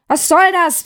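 loud, high angry bellow; roaring. Acted. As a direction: bark it out in outrage. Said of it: "brüllte der Weihnachtsmann".

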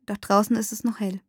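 plain reading voice

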